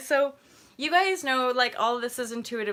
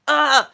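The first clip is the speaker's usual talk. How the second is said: exasperated ugh; 6 semitones higher, 12 dB louder than usual. Retold or imitated. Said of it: "I'm like".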